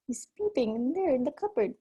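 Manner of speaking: usual speaking voice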